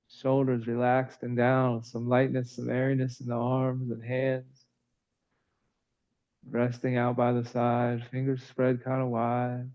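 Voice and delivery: calm, even yoga-teacher voice with long pauses; soothing, evenly paced breath cues; guided breathing meditation. Real, not acted. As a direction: guide the breath gently, in an unhurried monotone.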